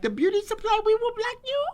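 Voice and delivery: very high put-on beauty-supply character voice; Kim Joy voice. Comedian voicing her alter ego against online haters, very high. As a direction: block the haters in a shrill-high comic persona. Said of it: "Kim Joy is a character I created".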